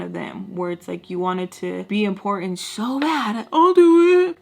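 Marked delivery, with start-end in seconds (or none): 3.5-4.4 mocking eager pick-me.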